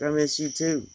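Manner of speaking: put-on little girl voice. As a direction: lightly voice a sweet small child. Child daughter voiced in story.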